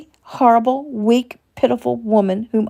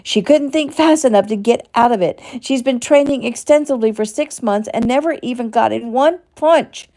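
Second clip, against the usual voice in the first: higher and louder, spilling over in rage; shouting; 7 semitones higher, 4 dB louder than usual. Acted. Shelley raging at her own weakness in therapy, shouting. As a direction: shout it at yourself, furious. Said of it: "Shouting now".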